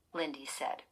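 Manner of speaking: ordinary delivery, nothing marked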